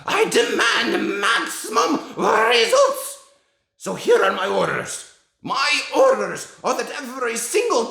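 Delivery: put-on very high, loud, slow shrieking with a Slavic accent and rolled R's; a dad screaming the witch's orders to his kids; Grand High Witch. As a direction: scream out her orders, cackling. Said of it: "here's what it sounds like when you read The Grand High Witch".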